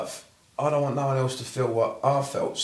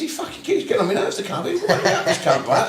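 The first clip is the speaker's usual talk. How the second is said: mock angry rant; 8 semitones higher, 3 dB louder than usual. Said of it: "I was angry with him".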